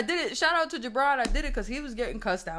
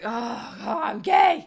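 sudden high rising blurt, louder at the end; blurted confession; 3 semitones higher, 6 dB louder, much slower than usual. Retold or imitated. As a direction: blurt it out all at once. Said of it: "I was like".

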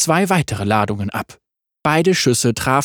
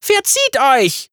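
shouting; 11 semitones higher, slower than usual. A narrator's reading. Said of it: "schrie sie".

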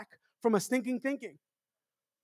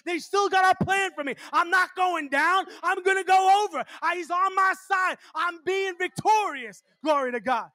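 preaching at full force; 7 semitones higher than usual. Acted. Preacher proclaiming victory to his congregation, fervent. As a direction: build to a victorious shout.

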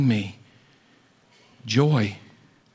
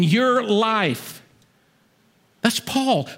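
high, fervent preacher's build; preaching at full force; 8 semitones higher than usual. Acted. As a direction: lift the room with Paul's story.

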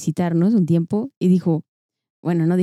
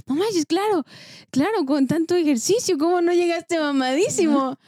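high-pitched mock amazement; amazed reply; 9 semitones higher than usual. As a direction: act stunned and impressed, way up high. Retelling her own amazed reply.

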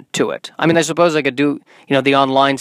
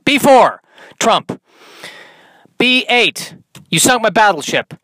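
higher-pitched mock game calls; mock Battleship player; 8 semitones higher, 3 dB louder than usual. Trump playing Battleship against an opponent, mock triumphant calls. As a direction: call out the moves with exaggerated high punch.

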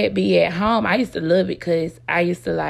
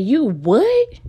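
high, loud stunned disbelief; shocked; 8 semitones higher, 4 dB louder than usual. Retold or imitated. Daughter hearing dad's new job, stunned high disbelief. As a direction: blurt it out in shock.

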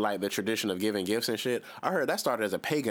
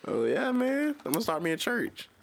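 gleeful; 10 semitones higher than usual. Acted. Gloating about starting a church.

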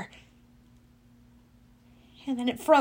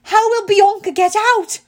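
high, loud, urgent; raised-pitch, worried shout; yelled question; 9 semitones higher, 8 dB louder than usual. A narrator's reading. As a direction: yell in alarm for a trapped friend. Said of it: "She yelled".

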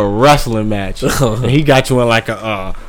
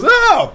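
high-pitched startled "oh"; imitated yelp as she gets shoved; mock cry of pain. Comic acting out a woman being pushed over, mock cry.

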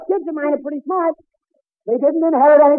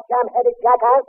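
normal talking voice

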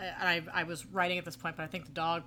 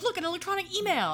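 goofy sitcom voice; 13 semitones higher, 4 dB louder than usual. Retold or imitated.

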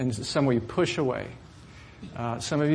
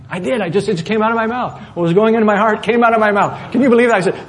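raised, louder voice reliving the anger at the clerk; retold angry outburst; 7 semitones higher, 8 dB louder than usual. Pastor reliving his outburst at the rental clerk, louder and heated. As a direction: let the old anger flare up again in the telling.

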